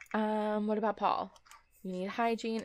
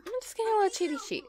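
high, fast, playful cheaty voice; mischievous; 10 semitones higher, much faster than usual. Real, not acted. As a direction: tease it like a little imp.

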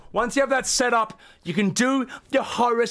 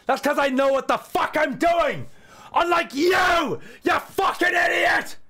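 higher shouted insult, slowing on unlike you; mock angry yell; 6 semitones higher than usual. Streamer mocking viewer's setup, hammed-up shouting. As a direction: scream the brag at them.

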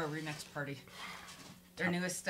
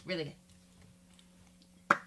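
whisper; 4 dB quieter, much slower than usual. Pretend. Sarcastic muttered repeat.

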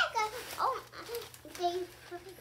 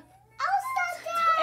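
high, loud and drawn out; excited shout; 11 semitones higher, 7 dB louder, much slower than usual. Real, not acted. Girl shouts at her new Elsa duck, excited. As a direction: squeal it with delight.

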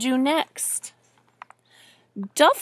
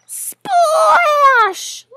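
very high, loud, drawn-out sound word; shouted splash; 10 dB louder than usual. A narrator's reading. Reader acts the duck hitting water, shouting. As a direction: whoop the splash, stretching it out. Imitates a big splash in story.

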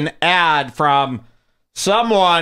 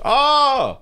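high drawn-out crowd "oh"; pitched-up mock group groan; imitating crowd reaction; 11 semitones higher than usual. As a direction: mimic an audience jeering. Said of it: "And everyone goes".

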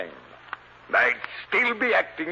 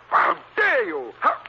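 indignant outrage; 12 semitones higher, 4 dB louder, slower than usual. Acted. Dobray outraged at accusation.

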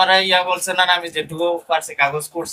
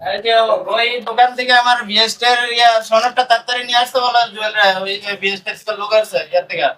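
raised voice, pitched up, calling across the shop; 7 semitones higher than usual. Real, not acted. Bakery owner calls across the shop to his worker to fetch the certificate, raised calling voice. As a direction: call out to the worker to fetch it fast.

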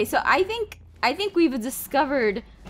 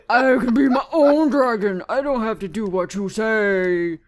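defiant dragon; 3 semitones lower, 4 dB louder than usual. Acted. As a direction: wail back like a rebellious dragon. Plays a defiant dragon answering back.

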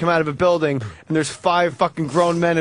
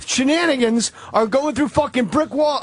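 retold indignant rant, higher pitched; crazy angry; 7 semitones higher than usual. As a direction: imitate a man riled up in protest. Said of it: "then he got crazy angry".

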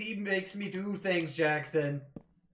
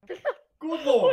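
laughing exclamation; 12 semitones higher, 11 dB louder than usual. Real, not acted.

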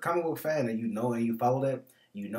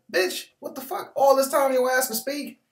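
high, loud, stunned Wolverine voice; shocked Wolverine; 9 dB louder than usual. Retold or imitated. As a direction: yell it like an outraged Wolverine. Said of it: "Hugh Jackson is like".

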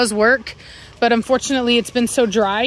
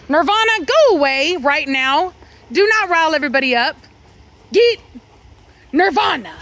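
scolding; 5 semitones higher than usual. Real, not acted. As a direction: tell the dog off firmly. Scolding dog.

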